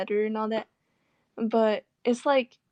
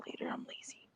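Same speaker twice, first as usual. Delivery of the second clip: whispered aside, almost no voice; 18 dB quieter than usual. Real, not acted. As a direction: breathe it out like a lazy confession. Muttered aside to herself.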